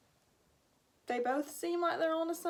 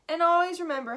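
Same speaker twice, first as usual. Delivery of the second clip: Irish brogue; 5 semitones higher, 8 dB louder than usual. Acted. Irish grandfather speaks.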